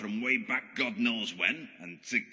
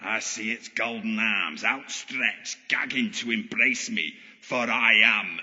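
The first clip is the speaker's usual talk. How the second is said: grandiose megalomaniac proclamation; pantomime supervillain. Acted. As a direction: declaim like a power-mad stage villain. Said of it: "pantomime supervillain act".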